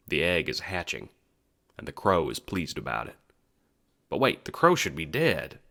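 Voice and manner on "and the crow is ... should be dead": ordinary talk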